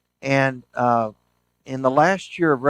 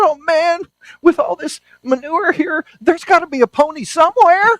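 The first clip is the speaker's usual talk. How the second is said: put-on high, loud, thrilled child's voice; the beaming boy digging through manure, full of hope; gleeful optimist kid; 8 dB louder than usual.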